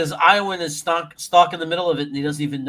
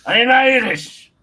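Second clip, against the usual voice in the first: put-on Irish accent; 6 semitones higher, 9 dB louder, slower than usual. Mock Irish claim, loud slow.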